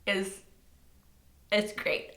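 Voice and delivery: ordinary talk, nothing marked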